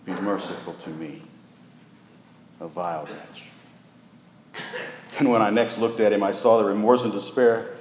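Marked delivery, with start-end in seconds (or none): 0.0-4.6 softer, lower, very slow plea; a broken man's slow whisper-like plea to God; contrite prayer. Retold or imitated. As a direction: beg for mercy in humble prayer. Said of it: "he lifted up his voice".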